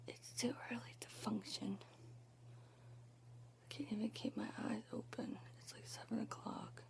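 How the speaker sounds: whispering so nobody wakes; hushed early morning whisper; quiet whisper. Real, not acted. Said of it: "I'm being quiet 'cause my mom is still sleeping".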